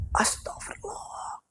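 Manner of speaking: slow, soft whispered astagfirullah; whispered prayer. Real, not acted. Teller reliving the fright, slow whispered prayer. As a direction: hush it out slowly in fear.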